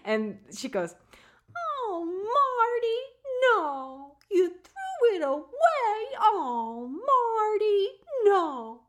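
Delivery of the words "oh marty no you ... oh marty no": very high, cute little Southern mother-in-law voice; drawn-out, high-pitched Southern mock dismay; Becky impression. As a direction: imitate an upset Southern mother wailing at her son. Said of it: "she's got this little Southern accent, and it's real high".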